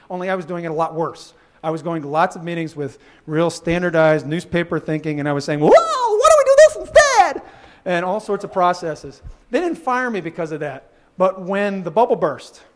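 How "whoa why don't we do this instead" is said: very high, loud, drawn-out mock yell; hammed-up wild enthusiasm, way up high; over-eager outburst. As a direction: burst in like an overexcited meeting disrupter. Acting out his outburst in meetings.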